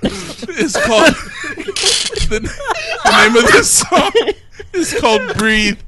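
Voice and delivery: trying to talk through laughter; laughing fit while speaking. Real, not acted. Laughing while introducing the song.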